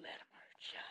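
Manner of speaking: soft whisper to the pig; hushed reassuring whisper. Real, not acted. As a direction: whisper comfort to a scared animal.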